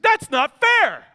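put-on high, loud, slow childish protest; kid whining. Son protesting to dad, high loud whine. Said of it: "He said".

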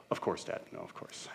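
put-on whispered, meek kid reply; a child's quiet sheepish yes, Dad. Kids whispering meekly to their dad.

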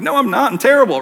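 mock protest. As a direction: protest like you can't accept the compliment. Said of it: "I'm like".

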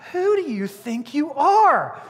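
slow, high-pitched, drawn-out indignation; indignant challenge. Acted. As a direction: challenge him, stretching out each word. Leaders challenge John, acted.

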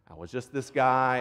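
ordinary talk

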